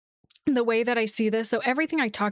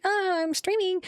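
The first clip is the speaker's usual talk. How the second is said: much higher, put-on bright streamer tone; performative streamer voice; 10 semitones higher than usual. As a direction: switch into stream-host mode, pitched way up. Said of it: "I kinda am like".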